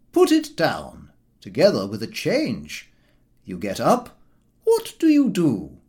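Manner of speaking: high-pitched uncle dictating a packing rule; Uncle Podger. Acted. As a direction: play the methodical uncle laying down the rules. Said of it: "my uncle would say".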